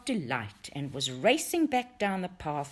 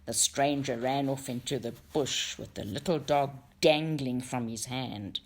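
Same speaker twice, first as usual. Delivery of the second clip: ordinary delivery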